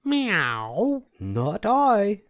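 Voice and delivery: high meowing cat voice; a cat mewing its refusal. A narrator's reading. Cat refusing to help the hen with a high meow. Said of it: "said the cat".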